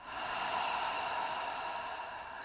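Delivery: slow, soft breath out; long demonstrated exhale. Shown as a demonstration. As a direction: let out a long, whispery sigh to model breathing. Models the exhale for listeners.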